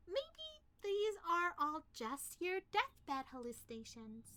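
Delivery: ordinary talk, nothing marked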